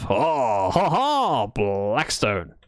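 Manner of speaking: lightly French-sounding, drawn-out exclamation; a hint of French, grandly stretched; accidental French accent. Real, not acted. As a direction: slip into a playful French flourish. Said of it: "I sounded very French there".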